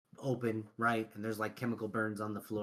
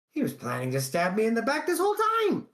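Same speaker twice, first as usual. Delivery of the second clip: louder, higher, fast angry outburst; bitter realization; 9 semitones higher, 6 dB louder, faster than usual. Acted. Betrayed shopkeeper rages at his partner's treachery, loud and fast. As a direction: spit out the betrayal.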